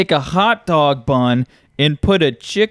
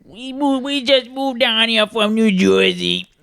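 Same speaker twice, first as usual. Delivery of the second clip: higher, nasal Jersey whine; New Jersey accent; 6 semitones higher than usual. Acted. Newcomer announcing a move from Jersey, nasal New Jersey accent. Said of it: "The Jersey accent".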